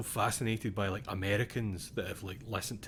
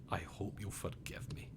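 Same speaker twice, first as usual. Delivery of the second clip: soft, near-whispered plea for forgiveness; a quiet, breath-only goodbye; hushed farewell; 8 dB quieter than usual. Acted. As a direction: let the voice drop away to a whisper. Reads out a suicide note's farewell.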